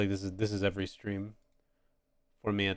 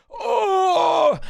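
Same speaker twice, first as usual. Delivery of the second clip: sudden, much higher, louder exclamation; startled shout of realization; surprised oh; 11 dB louder than usual. Real, not acted. Sudden realization while drawing.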